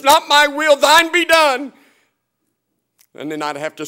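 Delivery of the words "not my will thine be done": high, loud surrender cried out to God; fervent prayer. Retold or imitated. Preacher crying out his surrender to God, loud and high. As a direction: pour it out as a loud, lifted prayer.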